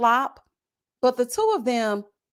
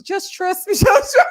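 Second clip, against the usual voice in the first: mock quote; 10 semitones higher, 6 dB louder than usual. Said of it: "speaking in tongues".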